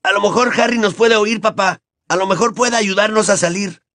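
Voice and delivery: high-pitched youngster suggesting a way out; high, eager suggestion; hopeful boy's voice. Acted. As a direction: pitch it up like a boy to his dad. Trapped boy calling to his father.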